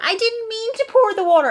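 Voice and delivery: high, loud fake-innocent child apology; aunt imitates the niece apologising mid-mischief; mock innocent child. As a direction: play the kid who is caught pouring water. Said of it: "like, she'll be pouring water".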